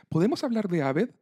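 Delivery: high, pleading character voice; voiced young woman character. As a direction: raise the pitch for Annie's concerned question. Voicing Annie in the story.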